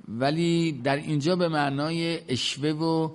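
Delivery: ordinary delivery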